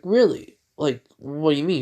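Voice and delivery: surprised. Retold or imitated. Retold surprised question.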